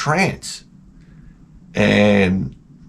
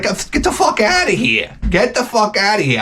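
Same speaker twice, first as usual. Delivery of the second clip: much higher, strained protest; mock outrage, pitched way up; indignant outburst; 9 semitones higher than usual. Husband arguing with wife over eating in bed, indignant. Acted bedroom quarrel with wife.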